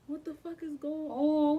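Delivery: loud, high-pitched alarm; retold panic. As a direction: demand answers in a reenacted panic. Acting out panic at the bleeding.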